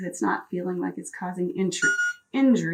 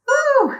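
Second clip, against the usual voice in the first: effort whoop; 9 dB louder than usual. Real, not acted. Effort cry.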